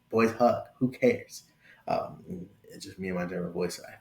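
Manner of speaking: ordinary talk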